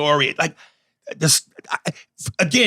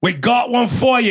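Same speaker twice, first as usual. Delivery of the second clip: loud, higher jeering shout to someone offstage; playful taunting yell; mock call-out; 6 semitones higher, 4 dB louder than usual. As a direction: holler for Brandon across the room. Calling out to absent Brandon.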